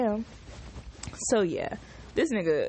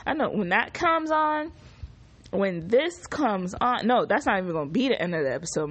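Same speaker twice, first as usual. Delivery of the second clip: ordinary talk, nothing marked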